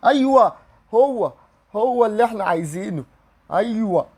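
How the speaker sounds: high, loud cry of joy; real joy bursting out; thrilled shout. Real, not acted. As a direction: yell it like you finally got him. Finally pulls the wanted player.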